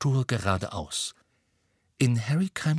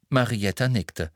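ordinary delivery, nothing marked

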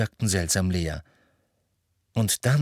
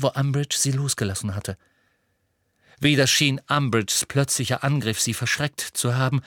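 plain reading voice

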